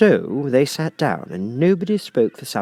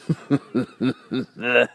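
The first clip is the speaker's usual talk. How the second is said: griffin chuckle. A narrator's reading. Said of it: "it chuckled".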